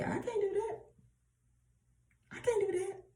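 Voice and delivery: very high-pitched mimicked refusal; mock helpless excuse. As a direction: squeak it like someone making excuses. Mimicking listeners' excuses to God.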